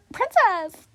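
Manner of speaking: high, loud exclamation; indignant outburst. Acted. Objecting to being called princess, indignant shout. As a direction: throw the word back, loud and high.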